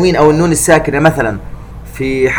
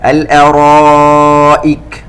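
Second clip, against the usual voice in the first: slow, elongated tajweed chanting; Quran recitation; 4 dB louder, much slower than usual. Shown as a demonstration. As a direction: recite it slowly and melodically. Recitation demo. (تلاوة مجودة بطيئة)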